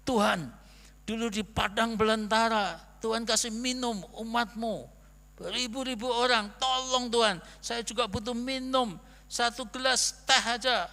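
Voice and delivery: loud, high, pleading prayer to God; fervent prayer. Retold or imitated. Thirsty on the train, begging God for tea: loud fervent plea. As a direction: cry out to the Lord, begging for a drink. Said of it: "saya berdoa sungguh-sungguh".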